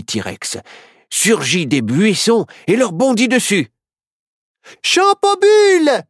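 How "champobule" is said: high, loud, drawn-out incantation; piercingly high spell casting; shouted magic spell. Acted. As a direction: cry out the spell to stop a monster. Witch casts a protective spell.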